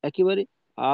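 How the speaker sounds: ordinary delivery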